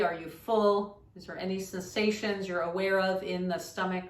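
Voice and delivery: soft-spoken yoga teacher leading a meditation; guided meditation. Shown as a demonstration. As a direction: guide listeners gently into stillness, quietly.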